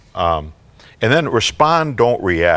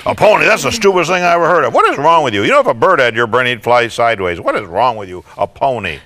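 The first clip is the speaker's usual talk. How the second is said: high, loud, fast tirade that fades toward the end; berating mother; 4 semitones higher, 5 dB louder than usual. Acted. Mom berating her eight-year-old over a pony, loud and scornful. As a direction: rant at the kid, then run out of steam. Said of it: "she's got a deep voice".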